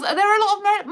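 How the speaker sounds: normal talking voice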